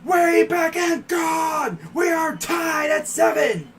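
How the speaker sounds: high, slow play-by-play shout; home run call. Shown as a demonstration. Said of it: "my call is gonna be crazy".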